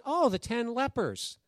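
high, drawn-out "oh" of sudden understanding; dawning realization. Retold or imitated. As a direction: act out finally getting it, pitched high and slow. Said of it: "The ten leopards?".

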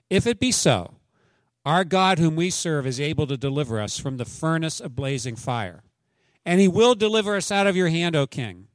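high, loud, defiant reading; preacher reading Daniel's defiance aloud; scripture reading. Acted. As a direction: declaim it like the three men before the king. Reads scripture with force.

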